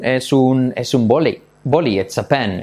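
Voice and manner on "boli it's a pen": normal talking voice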